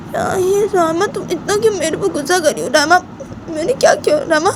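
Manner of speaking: put-on squeaky high girlish protest; childish protest. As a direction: protest your innocence like a sulky girl. Bani pleads with Rama.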